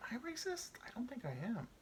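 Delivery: small, high, soft voice; hushed self-questioning. Acted. Quietly questions himself.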